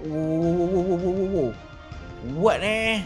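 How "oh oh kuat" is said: ordinary delivery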